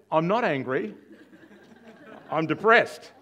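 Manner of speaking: high, loud acted protest; indignant denial. Patient contradicting his psychiatrist, indignant denial.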